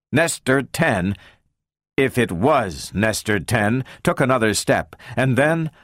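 ordinary delivery

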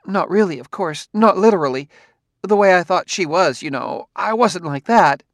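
rambling, nervous wife. A narrator's reading. Wife voiced in story.

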